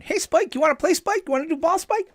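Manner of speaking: high, excited cartoon puppy voice; squeaky yappy little cartoon dog. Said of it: "Why did you sound like the bulldog in that Warner Brothers cartoon".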